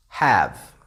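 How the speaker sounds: "have" said alone, low, ending on a clear v; teacher models "have" for pronunciation, low; word demo. Shown as a demonstration. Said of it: "have ends with a vowel".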